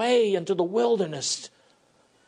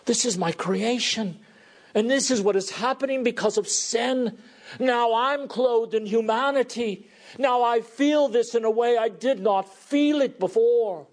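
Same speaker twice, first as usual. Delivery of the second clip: higher, rising inner cry of Christ at the tomb; anguished Jesus; 7 semitones higher than usual. Acted. Preacher voicing Jesus weeping at Lazarus' tomb, anguished. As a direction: voice his grief as it swells. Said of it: "when he stood before Lazarus' tomb".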